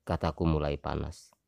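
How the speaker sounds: usual speaking voice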